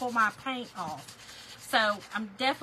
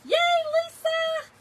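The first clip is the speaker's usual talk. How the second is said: excited cheer; 8 dB louder, much slower than usual. Real, not acted. Cheering a viewer's comment.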